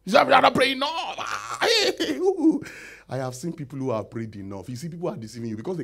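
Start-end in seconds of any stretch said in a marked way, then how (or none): 0.0-1.1 much higher, loud mocking nag; mocking scold. Preacher mocking people who blame weak prayer, loud and high. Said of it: "they say". 1.2-2.6 laughing exclamation. Real, not acted. Exclamation after mockery.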